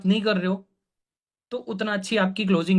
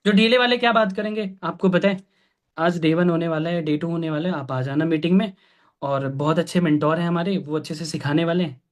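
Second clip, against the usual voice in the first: low-energy drone, sinking lower; slack, listless mentor; 2 semitones lower than usual. Shown as a demonstration. Mentor demonstrating how slack people invite prospects, listless. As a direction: say it limply, with no excitement. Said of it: "ढीले वाले, अच्छे वाले".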